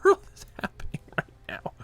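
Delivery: whispered in disbelief; stunned whisper. Real, not acted. At the opponent's baffling turn, whispered disbelief. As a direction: breathe it out, barely voiced.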